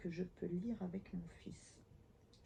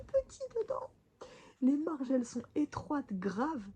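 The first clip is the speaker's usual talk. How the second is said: much higher and louder outburst; exclaiming; 8 semitones higher, 6 dB louder than usual. Real, not acted. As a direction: squeal the complaint about the margins. Complaining about tiny print.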